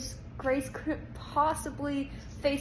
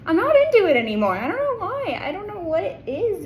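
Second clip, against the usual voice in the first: much louder and higher, fast; exasperated outburst; 7 semitones higher, 10 dB louder than usual. Real, not acted. Reader venting about a couple she fell out of love with, exasperated. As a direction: burst out in frustration.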